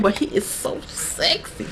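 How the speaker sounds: hushed, near-whispered admiration; swooning aside. Real, not acted. Fan confides about the werewolf guy, hushed swoon.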